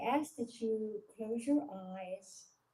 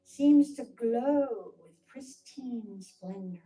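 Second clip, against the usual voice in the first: guided meditation. Acted. Leader talking listeners through a calming visualisation, guided meditation. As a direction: guide listeners into calm, phrase by measured phrase.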